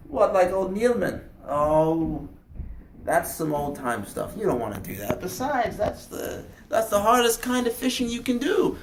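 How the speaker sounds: loud, animated grandfather waving the idea away; dismissive grandfather. Acted. Grandfather discouraging grandson from old-time fishing, dismissive. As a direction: talk the boy out of it.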